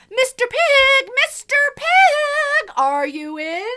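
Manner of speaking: loud, high, slow call. Acted. Wolf calling loudly up at the pig's brick house. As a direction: sing it out at the door. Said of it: "I called".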